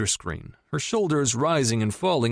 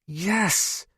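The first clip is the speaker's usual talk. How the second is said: tight, gritted-teeth yes; through gritted teeth; 10 semitones higher than usual. A narrator's reading. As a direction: force it through clenched teeth. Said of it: "Lo said through gritted teeth".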